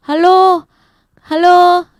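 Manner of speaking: loud, high call to passers-by; berteriak memanggil. Acted. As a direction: call out "halo" to anyone around. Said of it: "dia hanya berteriak kepada orang sekitar". (berseru keras memanggil orang)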